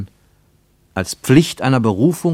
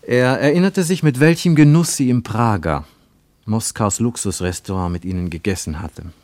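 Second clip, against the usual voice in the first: plain reading voice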